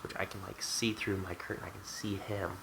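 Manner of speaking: normal talking voice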